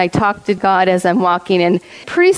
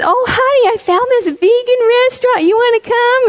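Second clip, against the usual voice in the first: sweet wife; 13 semitones higher than usual. Retold or imitated. Chuck voicing his wife sweetly.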